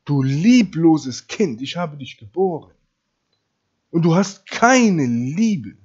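loud, slow mother's reproach; acted scolding of one's own child; reproachful mother. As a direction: stretch out the accusation, hitting it hard. Deine Mutter würde dir sagen.

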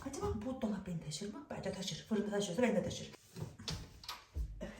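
hushed voice from inside the cupboard; whispering while hiding. Real, not acted. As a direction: keep it low so the seeker can't hear.

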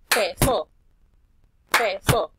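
"Peso" loud with PE punched; stress on PE. Shown as a demonstration. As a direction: hit the first syllable of "Peso". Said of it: "escuchen la palabra peso". (acento en la sílaba PE de "Peso")

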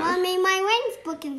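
loud whiny call. Pretend. Child calling to mommy.

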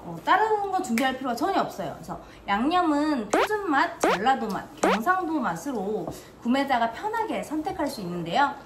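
차분한 제품 소개 낭독; 광고 읽듯 또박또박 설명하기; product review read. Acted. (시청자에게 김치 제품을 소개하는 리뷰 내레이션)

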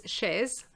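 chaise as 'shez'; sounded Z. Shown as a demonstration. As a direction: voice the final z of chaise. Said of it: "if we pronounce the Z".

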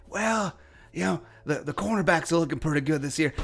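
a sleepy, lightly voiced coach musing about the draft; mocking Mike Zimmer. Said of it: "he's gonna be like".

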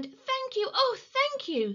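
high, gushing thanks; high-pitched heartfelt gratitude; grateful cry. A narrator's reading. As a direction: pour out relief as the rescued witch. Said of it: "the grateful witch cried".

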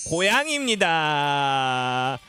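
very slow, sliding down low; drawn-out answer reveal. Real, not acted. Host announces the quiz answer to children, drawn-out reveal. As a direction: stretch the answer out for a big reveal.

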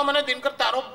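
high mock-admiring friend voice; teasing friend. Friend admiring Dinkar's penthouse, high mock-admiring voice. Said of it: "એ ભાઈબંધો કે".

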